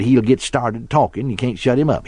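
ordinary talk, nothing marked

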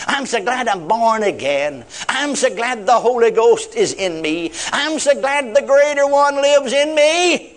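slow, high declaration building upward; glad, exultant prayer. Shown as a demonstration. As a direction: draw out each line with rising gladness. Models a glad morning prayer.

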